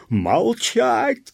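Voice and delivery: very high, loud scream of command; furious shout. A narrator's reading. Police officer silencing the peasant, furious shout. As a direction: scream the order. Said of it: "крикнул".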